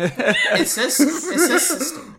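laughing, high and loud. Real, not acted. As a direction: crack up through it.

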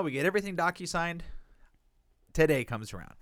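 ordinary talk

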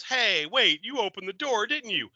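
a mock-excited accusation; accusing. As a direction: catch him out, playfully accusing. Retelling character catching someone.

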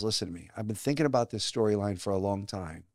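low, hushed producer confiding an idea; quiet, confidential impression of a producer; retold producer. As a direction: drop low and soft, measured, as the boss making a pitch. Retells producer's pitch in his office.

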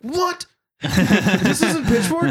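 very high-pitched, disbelieving yelp of feigned surprise; mock shock. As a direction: imitate someone pretending to be astonished. Retelling friend's feigned shock.

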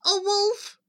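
high, anxious piggy voice; worried little pig; crying pig. Acted. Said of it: "Pig cried".

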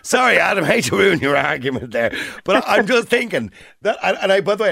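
high, giggly sorry through a laugh; laughing apology. Real, not acted. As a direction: apologise while laughing at his idea. Host laughs, apologising to caller.

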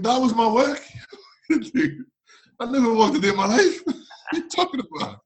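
high, loud protest breaking into laughter; indignant comic protest. Retold or imitated. Defending his football CV to his friend, laughing indignantly. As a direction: argue back at full pitch, laughing through it. Acted argument over the CV.

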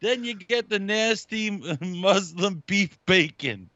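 high, slow deli cook voice, laughing through it; Aki impression. Guest keeps imitating Aki building the breakfast. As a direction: list the gross order like the deli guy. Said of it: "He's like".

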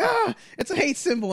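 high, whiny mock alarm; mock outrage. Mocking offended people with high outrage. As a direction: squeal it like a scandalised critic.

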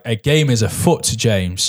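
ordinary delivery

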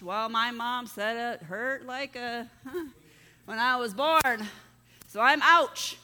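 a hint of an embarrassed grown man; mock sheepish explanation. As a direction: play it for laughs, building to a loud "ouch". Acted reply explaining the name.

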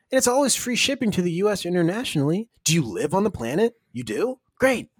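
high upbeat announcer pitch; ad read. Acted. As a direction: push the promo code like radio. Ad read call to action.

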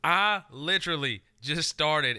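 loud protest. Real, not acted. Heated rebuttal.

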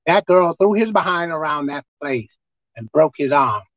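usual speaking voice

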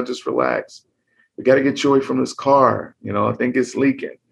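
calm the injured while taking charge; reassuring bystander. Acted. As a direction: bystander reassuring both drivers. Bystander voiced calming victims.

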